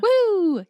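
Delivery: high, loud celebratory whoop; cheering woo. Real, not acted. Cohost cheering Minnesota's own actress, whooping. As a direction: whoop like a hometown fan.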